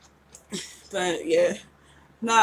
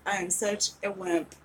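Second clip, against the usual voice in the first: ordinary delivery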